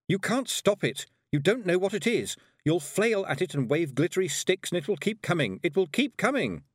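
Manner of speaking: high, urgent warning cry; panicked shout. A narrator's reading. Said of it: "shouted at them".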